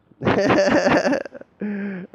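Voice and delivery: loud, high burst of laughter. Real, not acted. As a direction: howl with laughter. Loud laugh after joke.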